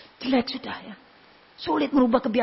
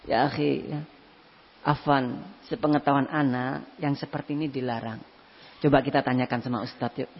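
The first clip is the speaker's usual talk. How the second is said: gentle advice; 8 semitones lower, 8 dB quieter than usual. Retold or imitated. Friend softly advising a brother.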